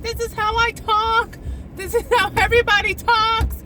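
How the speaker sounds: high-pitched indignant self-defense; acted New Yorker protest. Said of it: "And you're gonna say, "I'm not yelling".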